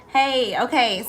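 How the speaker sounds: ordinary talk, nothing marked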